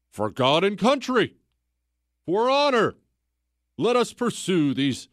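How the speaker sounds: pompous knight's call to charge; mock battle cry. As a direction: rouse the troops for honor, mockingly.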